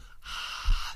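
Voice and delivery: loud breathy whoosh; mimed burst of breath; imitated selfie noise. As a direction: push out a loud, voiceless rush of air. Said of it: "people doing the whole".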